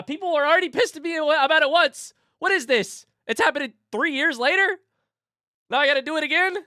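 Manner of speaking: high, loud mock outrage; exasperated outburst. Acts out exasperation at repeated complaints.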